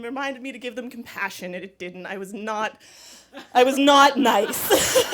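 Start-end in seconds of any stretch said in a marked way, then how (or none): 3.5-5.1 slow, louder, laughing through the words. Real, not acted. Storyteller admits her revenge to the audience, laughing. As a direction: crack up while confessing it.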